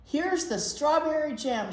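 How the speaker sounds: shout. Acted. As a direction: shout it out proudly. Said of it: "shouted Jack".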